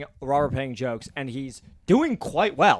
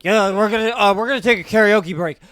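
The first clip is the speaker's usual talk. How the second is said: slightly frantic host, louder and higher; show host announcement; 6 semitones higher, 8 dB louder than usual. Retold or imitated. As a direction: call it out to the room. Host announces a break onstage.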